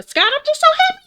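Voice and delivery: very high, sugary mock welcome; mock sweet voice. Friend greets Scott at the dinner intervention, high and sugary. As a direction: put on a fake-sweet high voice. Mocking Heavenly's fake sweetness.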